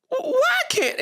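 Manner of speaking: imitating a stand-up comic's exasperated high cry; Cat Williams voice. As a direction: wail it up high like a comic doing a bit on stage. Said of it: "in my Cat Williams voice".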